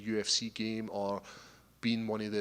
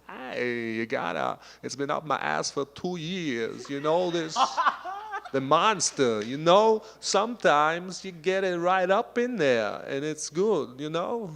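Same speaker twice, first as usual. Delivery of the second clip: high, drawn-out celebrity impression with odd swells; hammed-up actor imitation; Christopher Walken; 7 semitones higher, 9 dB louder, slower than usual. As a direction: stretch key words slowly and push them up loud. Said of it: "Christopher Walken".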